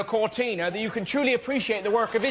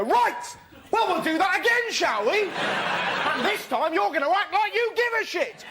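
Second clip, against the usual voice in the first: high, indignant scolding; mock annoyance; 8 semitones higher than usual. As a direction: scold them in exaggerated annoyance, pitched high. Gets really annoyed.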